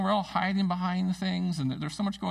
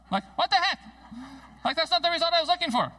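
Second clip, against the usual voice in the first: much higher and louder, fast frustrated complaint; exasperated outburst; 10 semitones higher, 4 dB louder, faster than usual. Real, not acted. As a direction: burst out in disbelief, then rattle on. Venting about thyroid result.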